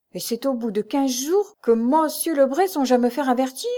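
bitter, rising protest; bitter anger. A narrator's reading. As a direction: demand it with wounded, angry reproach. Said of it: "avec amertume et colère".

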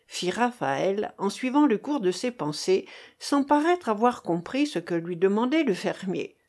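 ordinary delivery, nothing marked

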